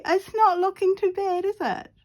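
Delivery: usual speaking voice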